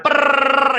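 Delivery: high buzzing lip trill; mock beatbox. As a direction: imitate rapping with a lip buzz. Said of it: "so I just started".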